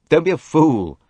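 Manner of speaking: harsh. Acted. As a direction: cut her off, harsh and loud. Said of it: "said Simon harshly".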